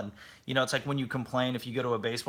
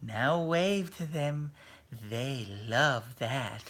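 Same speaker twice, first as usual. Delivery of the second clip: put-on high, drawn-out sing-song to children; old ride lady; 6 semitones higher, slower than usual. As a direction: imitate an elderly host slowly coaxing little kids. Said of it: "And then she goes".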